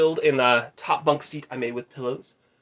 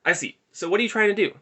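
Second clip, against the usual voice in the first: slightly put-on, higher-pitched questioner; lightly voiced Justin; 8 semitones higher, 3 dB louder than usual. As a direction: lightly act out an awkward small-talker. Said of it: "our conversation went something like this".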